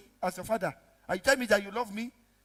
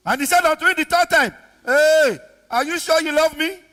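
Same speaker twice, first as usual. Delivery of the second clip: loud, high challenge; full-force preaching; 8 semitones higher, 11 dB louder than usual. Real, not acted. Preacher putting the third question to Peter, full-force preaching. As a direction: press the question loudly from the pulpit.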